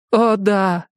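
высокий голос пожилой женщины; мягкая разговорчивая старушка. A narrator's reading. Jenny answers. (голос старушки)

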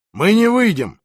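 loud, high, emphatic refusal; firm refusal. A narrator's reading. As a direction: refuse outright, projecting hard. Твёрдый отказ на переговорах. (громко и твёрдо)

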